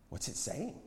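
soft, eager question; hushed eagerness. A narrator's reading. Young one asks about the voice, quietly eager. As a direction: ask keenly under your voice. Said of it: "she said eagerly".

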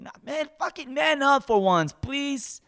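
high, loud "man up" yell; loud scolding. Retold or imitated. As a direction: bark at him to toughen up. Yelling at scared Harry.

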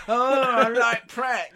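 high, slow sneering imitation; posh mocking voice. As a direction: mimic him as a snob. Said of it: "of course you fucking do, you wretched turd".

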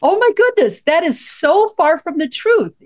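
high-pitched mock shock on the retelling; retold astonishment. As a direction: recount it as if nearly falling off the chair. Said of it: "I about fell out of my chair".

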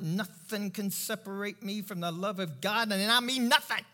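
loud, driving sermon delivery with punched key words; fired-up preaching. Real, not acted. Preacher urging congregation to assure their hearts, fired-up preaching. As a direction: preach it with rising conviction. Preaches scripture at full force.

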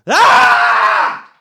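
loud, high, drawn-out scream; exasperated scream. Shown as a demonstration. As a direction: burst into a scream of frustration. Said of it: "screamy and angry right now".

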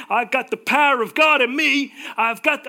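loud, high, hammed-up American accent. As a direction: belt it out in a mock American preacher twang. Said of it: "I do in an American accent".

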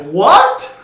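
loud, rising mock-outraged what; shouted catchphrase. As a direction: punch it out like a soundboard drop. Repeats catchphrase for a soundboard.

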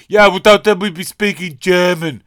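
hammed-up know-it-all; mocking everyone. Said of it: "everyone goes like".

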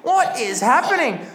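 loud, high, frantic calling; frantic commentary. Acted. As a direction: call it in rising panic. Commentator watching player choke.

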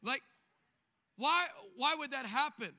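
ordinary talk, nothing marked

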